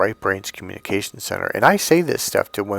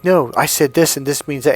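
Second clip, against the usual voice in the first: higher, louder "No" firing back; scene: wife arguing back at her husband, louder; wife's rebuttal; 5 semitones higher, 4 dB louder than usual. Retold or imitated. Mock heated rebuttal. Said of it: "and you're like".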